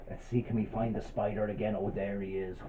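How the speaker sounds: ordinary delivery, nothing marked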